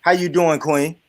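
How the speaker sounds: ordinary talk, nothing marked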